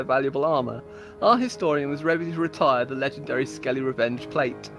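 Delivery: ordinary delivery, nothing marked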